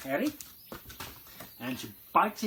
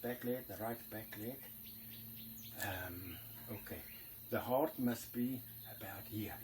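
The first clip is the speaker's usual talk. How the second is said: hushed whisper to himself; whispering while playing dead; 5 semitones lower, 11 dB quieter than usual. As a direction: whisper so the lion won't hear. Hunter feeling for the heart.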